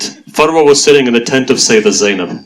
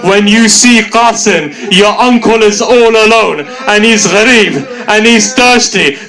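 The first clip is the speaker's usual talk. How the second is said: peak the mourning as the climax; impassioned elegy; climactic lament; 9 semitones higher than usual. Acted. Climax of recitation.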